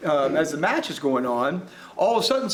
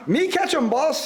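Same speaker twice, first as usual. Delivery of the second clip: chortle; 8 semitones higher than usual. A narrator's reading. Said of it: "he chortled".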